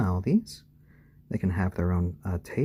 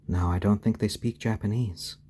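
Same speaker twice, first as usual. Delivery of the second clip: ordinary talk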